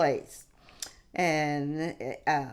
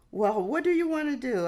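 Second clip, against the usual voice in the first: raised, quick mock-friend voice; friend's question; 8 semitones higher, faster than usual. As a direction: toss the question back lightly. Friend asks about weekend plans.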